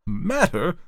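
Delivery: high, indignant exclamation; high-pitched scream of disbelief. A narrator's reading. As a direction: throw the word back in outrage. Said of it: "screamed the man".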